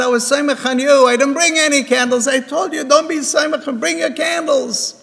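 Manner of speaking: acted remonstrating. Father and son argue over candles.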